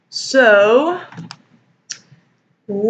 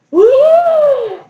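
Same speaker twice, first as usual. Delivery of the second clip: much higher, loud woo; 1 hammed-up gasp whoop; 6 dB louder than usual. The family gasping at Bella, mock loud whoop. All looked at Bella and gasped.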